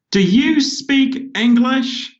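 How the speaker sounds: slow, high, deadly serious question; serious waitress. Retold or imitated. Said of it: "looked at me very seriously and she said".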